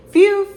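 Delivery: high, loud "phew"; relieved phew. Real, not acted. Shopper relieved the freezer door closed, loud relieved phew. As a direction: let out a big, high sigh of relief.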